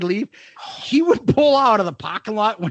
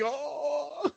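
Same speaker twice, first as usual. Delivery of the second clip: soft, drawn-out "oh"; dismayed groan; 5 semitones higher, 13 dB quieter than usual. Retold or imitated. Teacher hearing the old nickname, soft mock dismay. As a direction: let out a small deflated moan.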